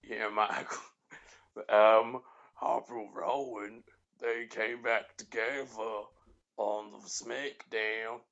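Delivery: slow, drawn-out Southern commentator; JBL impression. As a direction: stretch the words in a mock drawl. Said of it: "Go with that".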